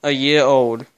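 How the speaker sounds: normal talking voice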